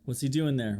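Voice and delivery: ordinary delivery, nothing marked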